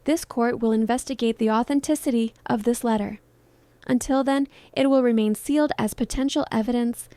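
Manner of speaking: plain reading voice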